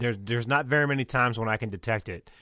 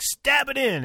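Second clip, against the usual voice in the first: put-on shouted "Stab it in" with an Australian accent, much higher and louder; imitating her Australian accent; 7 dB louder, slower than usual. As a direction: yell "Stab it in" the way she did, Australian accent slipping through. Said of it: "her accent comes back a little bit".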